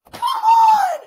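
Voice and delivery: very high, loud scream of protest; exasperated yell. Real, not acted. Fan protesting the cliffhanger, exasperated yell. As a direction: scream it out in real frustration. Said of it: "wondering why I'm yelling".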